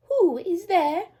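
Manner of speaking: put-on high, slow old-lady voice; a frail grandma calling through the door; old grandmother. A narrator's reading. Grandmother calling from bed, high and slow.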